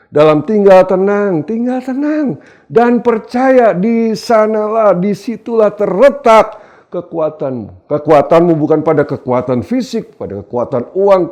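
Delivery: high, loud, drawn-out proclamation; ringing pulpit declaration; emphatic preaching. Real, not acted. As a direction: stretch each phrase for the congregation.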